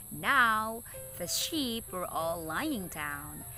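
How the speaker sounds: usual speaking voice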